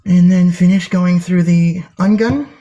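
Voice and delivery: "ungun" said as ungun, onion mangled into ungun; TikTok guy's onion. Retold or imitated. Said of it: "as that one guy would say on TikTok".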